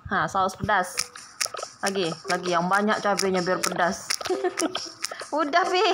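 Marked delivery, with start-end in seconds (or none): 4.1-5.3 airy giggle; breathy laugh. Real, not acted. Laughs at extra chili. (tawa berdesah)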